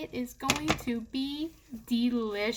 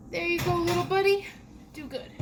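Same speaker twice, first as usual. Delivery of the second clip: talking to a pet; 4 semitones higher, faster than usual. Real, not acted. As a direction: coo at it like a pet. Talking to casserole.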